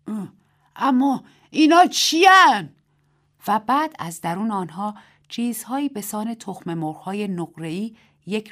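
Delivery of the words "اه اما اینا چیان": high, loud and slow astonished yell. A narrator's reading. Old Mrs Wang staring at the planes, slow loud yell. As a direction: cry out at the sky in amazement. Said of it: "داد زد".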